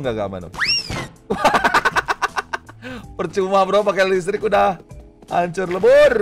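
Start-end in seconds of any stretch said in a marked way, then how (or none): 1.3-2.6 shrieking laugh. Real, not acted. Laughing at collapse.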